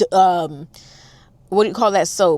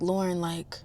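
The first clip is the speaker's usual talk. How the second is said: soft reassuring; 3 semitones lower, 13 dB quieter than usual. Retold or imitated. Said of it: "he was like".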